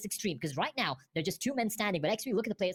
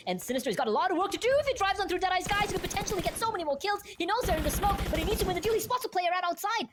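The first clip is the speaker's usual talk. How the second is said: rising hype, louder and higher; esports caster; 9 semitones higher, 6 dB louder than usual. Real, not acted. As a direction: build the call to a roaring peak. Caster hyped by a clutch fight.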